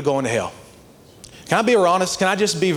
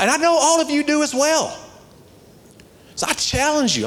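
high-pitched, emphatic sermon delivery; rally the church with a raised pitch; fired-up preaching; 7 semitones higher than usual. Real, not acted.

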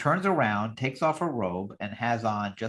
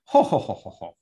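a dainty ladylike titter; prim titter; 3 semitones lower, 4 dB louder than usual. Retold or imitated. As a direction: titter politely like a lady in training. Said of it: "I'm practicing my titter".

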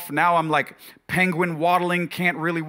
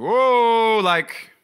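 loud, high exclamation of alarm; big alarmed whoa; startled whoa; 6 semitones higher, 5 dB louder than usual. Retold or imitated. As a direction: jolt back in disbelief. Reacting to no pain pills.

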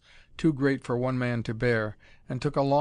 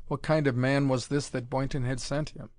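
plain reading voice

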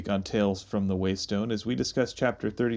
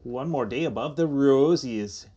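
slow, rounded Minnesota vowels; stretched-out Midwestern send-up; mock Midwestern accent; slower than usual. Co-host redoing the sign-off, mock Minnesota accent. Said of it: "It's really Midwestern".

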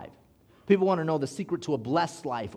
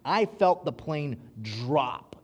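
ordinary talk

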